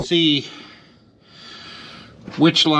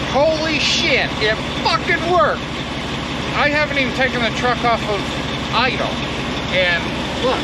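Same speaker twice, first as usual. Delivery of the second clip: thrilled; 9 semitones higher than usual. Real, not acted. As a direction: burst out in real delight. Repair worked, overjoyed.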